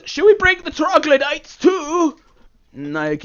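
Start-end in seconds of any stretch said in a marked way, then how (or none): 0.0-2.2 mushroom voice. Acted. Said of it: "one of the mushrooms says".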